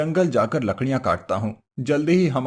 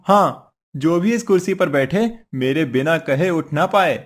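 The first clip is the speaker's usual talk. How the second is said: ordinary talk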